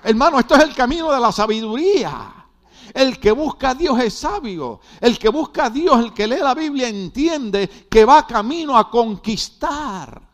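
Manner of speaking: loud, high, fervent preaching; full-force preaching. Acted. Pastor rousing his congregation, full-force preaching. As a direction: preach it out to the whole church.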